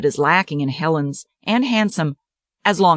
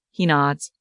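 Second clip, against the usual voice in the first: usual speaking voice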